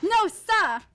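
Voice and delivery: sharp, loud, startled denial; a constable jolted awake, shouting back; loud no sir. Acted. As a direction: jolt upright and shout it. Constable snaps to attention.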